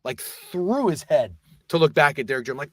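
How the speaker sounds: ordinary delivery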